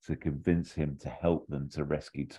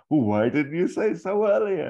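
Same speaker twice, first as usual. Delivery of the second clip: Sarah quote; 12 semitones higher, 6 dB louder than usual. Acted. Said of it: "something Sarah says".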